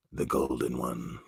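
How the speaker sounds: very low, soft murmur; hushed echo. Pretend. Repeats the name, hushed and low.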